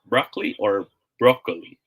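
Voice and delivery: "broccoli" as BRAH-klee, then BROH-ko-lee; open-O "broccoli", then long-O "broccoli"; two pronunciations contrasted. Shown as a demonstration. Teacher asks smiley or wink, contrasting broccoli pronunciations. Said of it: "Smiley face or wink face?".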